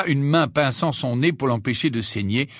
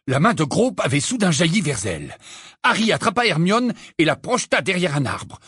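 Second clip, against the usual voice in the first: ordinary delivery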